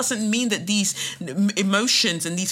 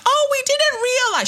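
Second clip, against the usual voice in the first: high, loud sarcastic innocent voice; sarcastic high-pitched fake innocence; mock fake apology; 4 dB louder than usual. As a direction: play the feigned surprise. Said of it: "be like".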